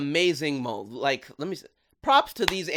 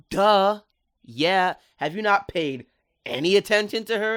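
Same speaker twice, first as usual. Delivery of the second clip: louder, higher mocking "duh"; sassy duh; 6 semitones higher, 6 dB louder than usual. King sassing the mom, obvious duh tone. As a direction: talk down to her like it's obvious. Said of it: "King was just like".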